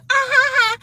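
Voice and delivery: high, put-on coy giggle; little ladylike laugh. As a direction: mimic that knowing feminine titter. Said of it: "that little laugh ladies we give".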